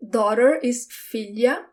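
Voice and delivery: usual speaking voice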